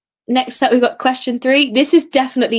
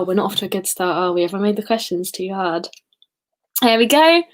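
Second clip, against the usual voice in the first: ordinary talk, nothing marked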